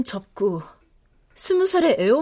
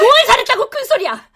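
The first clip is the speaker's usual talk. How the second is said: much higher, loud scolding; furious retort; 11 semitones higher, 5 dB louder than usual. Acted. Mother scolds her daughter for raising her voice, furious. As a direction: cut her down hard.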